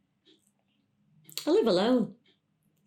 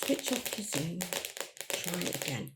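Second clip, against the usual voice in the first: soft, low repeat to oneself; deflated muttering; 3 semitones lower, 10 dB quieter than usual. Acted. Caller alone after failed instructions, muttering softly. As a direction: mutter it under your breath, giving up.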